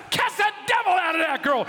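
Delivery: high, loud laughing order; a hammed-up preacher's order; commanding shout. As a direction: bark the command at him. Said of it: "He goes".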